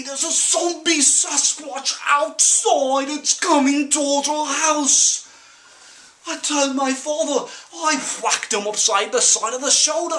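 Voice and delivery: put-on high, loud, urgent rural-accented warning; frantic pleading. Son begs parents to fight the zombie, frantically. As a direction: beg them to believe you, pitched right up.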